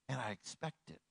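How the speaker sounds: ordinary talk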